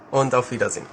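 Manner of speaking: ordinary delivery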